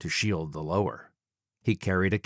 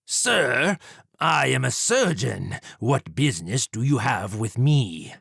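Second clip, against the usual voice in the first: higher, haughty gentleman villain; arrogant Jack; 3 semitones higher than usual. Acted. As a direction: brush him off like a dapper killer. Villain Jack voiced in story.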